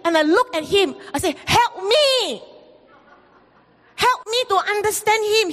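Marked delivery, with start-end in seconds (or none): 1.5-5.5 higher, half-comic pleading; mock plea. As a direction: beg a friend for rescue. Pleading with Pastor Tony.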